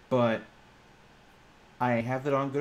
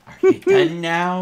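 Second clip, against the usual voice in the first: slow and louder, fed up; exasperated; 8 semitones higher, 15 dB louder, slower than usual. Real, not acted. GM to the joking players, fed up and loud. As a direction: drag it out, done with the interruption.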